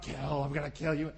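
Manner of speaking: low monster voice; horror-film monster. Retold or imitated. The thing coming out at picnickers, mock monster menace. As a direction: growl it low, hammed-up menace.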